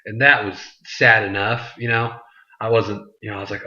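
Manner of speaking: ordinary talk, nothing marked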